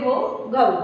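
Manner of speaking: घो and घऊ with a clear breathy gh at the start; correct pronunciation. Shown as a demonstration. Teacher correcting how घो and घऊ are read. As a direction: say घो and घऊ with the aspirated gh. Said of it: "पर इसको पढ़ते हैं".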